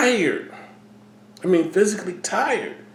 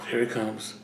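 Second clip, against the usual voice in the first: a sigh, then low and much softer; sighing dread; 8 semitones lower, 9 dB quieter than usual. Retold or imitated. Man at church sensing panic, sighing low and soft. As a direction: let the dread slip out under your breath.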